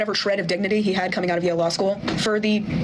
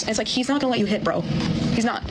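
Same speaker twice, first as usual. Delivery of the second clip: ordinary talk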